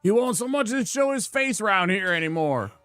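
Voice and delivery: hint of a fed-up village blacksmith; grumpy smithy. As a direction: play the cranky smith complaining about his apprentice. Gruff smithy NPC voice read aloud.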